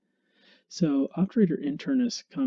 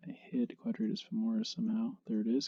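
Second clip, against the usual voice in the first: whispering; 8 dB quieter than usual. Real, not acted. Muttered aside while searching.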